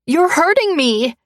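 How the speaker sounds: high, loud pained cry. A narrator's reading. Woman in a painful grip, high loud pained cry. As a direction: cry out in pain. Heroine in pain.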